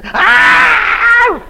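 a high, loud retold scream; mock scream. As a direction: let out her scream, hammed up. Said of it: "And her still screaming".

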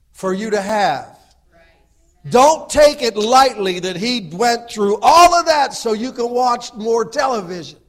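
high, loud, impassioned preaching; preaching at full force. Acted. Pastor exhorting the men of the church, fiery and loud. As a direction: build the fire and hammer each phrase.

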